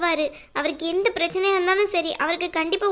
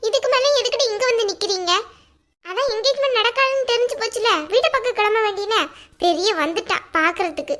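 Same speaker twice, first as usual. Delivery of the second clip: contemptuous scolding; 3 semitones higher than usual. Acted. Mother scolds the girl.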